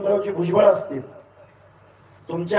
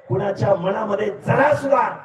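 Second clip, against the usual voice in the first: normal talking voice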